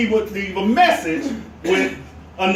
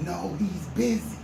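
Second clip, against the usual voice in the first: softly whispered no; 5 semitones lower, 11 dB quieter than usual. A narrator's reading. Boy whispering to the boss about the policeman. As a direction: hush it like a hiding kid. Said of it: "And he whispered".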